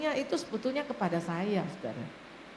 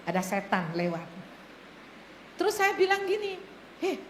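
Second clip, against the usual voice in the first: ordinary delivery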